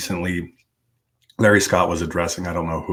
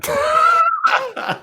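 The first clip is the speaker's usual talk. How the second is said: high squeaky mock emphasis; mock dramatic. Real, not acted. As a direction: stretch the name out mockingly. Mocking the conference name.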